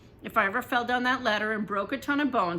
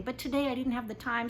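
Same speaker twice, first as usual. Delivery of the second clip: ordinary delivery, nothing marked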